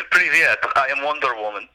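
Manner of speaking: mock Russian superhero introduction; hammed-up Russian accent; thick Russian accent. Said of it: "she just had a thick Russian accent".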